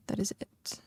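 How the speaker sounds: soft voiceless whisper. Real, not acted. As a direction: drop to a breathy aside. Whispered aside while gathering wood.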